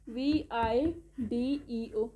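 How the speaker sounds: ordinary talk, nothing marked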